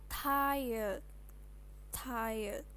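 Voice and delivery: "tired" said slowly as TAI-yed, R barely there; English pronunciation. Shown as a demonstration. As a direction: model "tired" twice, drawn out, tai-yed.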